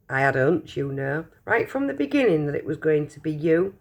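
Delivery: usual speaking voice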